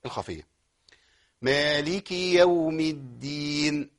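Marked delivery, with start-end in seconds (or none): 1.4-3.9 slow, measured recitation; Quran recitation. Shown as a demonstration. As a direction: recite slowly and evenly. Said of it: "انتوا عارفين الخطأ المشهور". (تلاوة متأنية)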